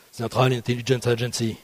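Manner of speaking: Central Intelligence Agency in tiefem, leisem amerikanischem Akzent; mock American accent. Shown as a demonstration. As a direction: spielerisch übertriebener US-Akzent. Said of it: "toujours avec mon super accent".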